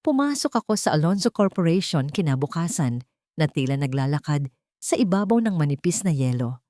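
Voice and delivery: ordinary delivery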